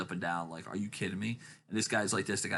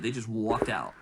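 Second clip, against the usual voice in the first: ordinary talk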